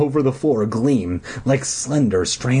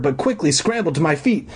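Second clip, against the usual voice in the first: ordinary delivery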